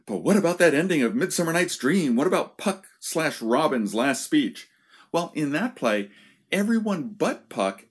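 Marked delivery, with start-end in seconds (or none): 0.0-4.7 higher-pitched mock protest; imagined listener's objection. Lecturer voices what listeners are thinking, higher-pitched objection. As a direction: jump in with an eager counter-question. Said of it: "I know what some of you are thinking".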